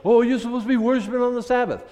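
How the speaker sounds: high, loud and quick, mimicking a know-it-all; mocking quote. Preacher mocks people who correct him, high loud mock voice. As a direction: sneer it out fast like a know-it-all. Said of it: "People come to me and say".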